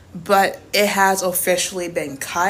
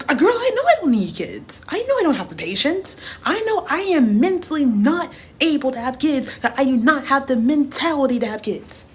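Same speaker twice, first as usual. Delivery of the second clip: exasperated rant; 6 semitones higher than usual. Real, not acted. Ranting about not wanting kids.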